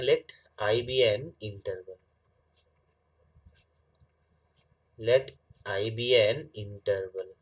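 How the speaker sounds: slow dictation with long pauses. Real, not acted. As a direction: dictate it slowly, phrase by phrase. Dictates definition while writing. (धीरे-धीरे लिखवाते हुए)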